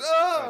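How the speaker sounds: loud, high hammed-up cry of defeat; overdramatic groan. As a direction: ham up the fall with a big oh. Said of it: "over dramatizes his fall".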